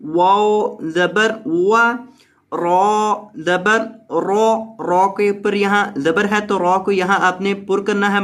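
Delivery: slow, measured letter-by-letter reading; syllable-by-syllable spelling. Shown as a demonstration. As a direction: build the word slowly sound by sound.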